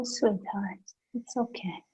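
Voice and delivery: soft, hushed comfort; soothing a baby. Retold or imitated. Therapist voicing comfort to a screaming baby, hushed and tender. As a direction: cradle the words like a crying baby. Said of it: "we just hold it and say".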